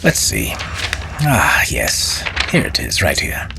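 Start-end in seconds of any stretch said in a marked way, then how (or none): none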